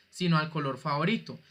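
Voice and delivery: normal talking voice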